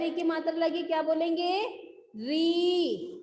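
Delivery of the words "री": "री" with the ee held long; long ee vowel. Shown as a demonstration. Teacher modelling the matra for students, long-drawn "री". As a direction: stretch out the vowel of "री". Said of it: "लंबा होता है ना".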